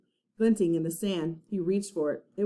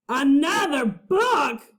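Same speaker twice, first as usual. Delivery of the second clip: slow, loud pirate roar; 6 semitones higher, 5 dB louder, slower than usual. A narrator's reading. Captain Bones roaring at another book, slow loud pirate roar. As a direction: roar in disgust. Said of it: "roared Captain Bones".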